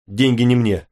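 short, abrupt reply; curt. A narrator's reading. Driver to passenger who offers to pay, curt. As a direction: answer curtly, without ceremony. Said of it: "всё так же отрывисто".

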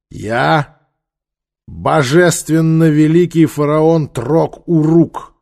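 slow, loud, grand announcement; proclaiming pharaoh. Acted. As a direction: proclaim your royal title. Ruler proclaims his title.

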